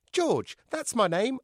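high, cheeky puppet voice. A narrator's reading. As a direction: wink at the crowd. Puppet George speaks.

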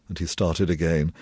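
ordinary delivery

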